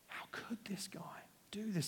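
whispered outrage among the servants; outraged whisper. Acted. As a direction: hiss it in shocked disbelief.

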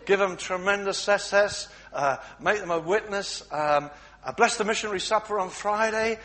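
loud, flustered praying; flustered wife blurting out prayers loudly. Acted. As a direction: scramble to pray after being caught.

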